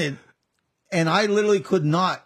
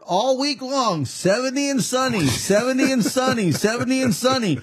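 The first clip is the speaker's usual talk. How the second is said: mocking the friend; 7 semitones higher than usual. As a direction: repeat it like a cheerful forecast. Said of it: "he was telling me before".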